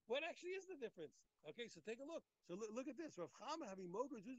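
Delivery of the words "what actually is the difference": excited question. Real, not acted. Teacher presses the key question.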